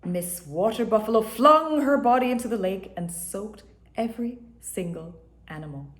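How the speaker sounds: usual speaking voice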